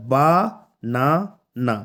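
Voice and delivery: বা, না, না as three separate beats; syllable by syllable. Shown as a demonstration. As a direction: pause after each of বা, না, না. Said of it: "তিনটি সিলেবল দিয়ে গঠিত হয়েছে".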